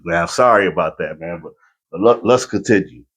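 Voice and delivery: ordinary talk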